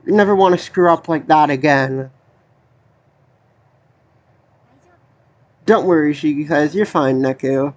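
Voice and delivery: low, even voice reading the characters' lines; reading game dialogue. Acted. Reads game dialogue aloud.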